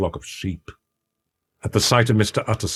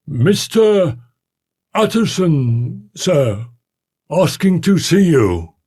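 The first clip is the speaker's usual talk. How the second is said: high, slow, raised-voice announcement; calling out; 11 semitones higher, 5 dB louder, slower than usual. A narrator's reading. Butler announcing a visitor to his shut-in master, calling out. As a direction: call it loudly through a door, drawn out. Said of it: "he called".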